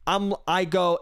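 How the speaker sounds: usual speaking voice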